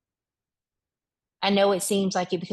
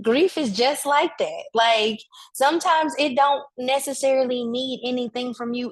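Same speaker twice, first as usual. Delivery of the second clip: preaching emphasis; 6 semitones higher, 4 dB louder than usual. Real, not acted. Preacher declares point forcefully.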